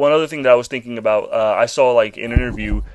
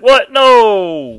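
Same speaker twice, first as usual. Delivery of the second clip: loud, high drawn-out no; mock disbelief; 11 semitones higher, 9 dB louder than usual. Host retelling his reaction to the show, loud mock disbelief. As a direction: cry out in exaggerated shock. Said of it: "I was like".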